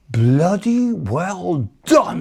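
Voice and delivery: high, drawn-out congratulations; emphatic praise. Retold or imitated. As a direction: imitate a sergeant heaping on slow, swooping praise. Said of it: "he looked me in the eye and said".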